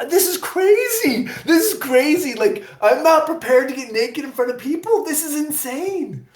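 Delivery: hammed-up freak-out, pitch way up; panicked disbelief. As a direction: squeak in comic alarm. Retelling panic before nude scene.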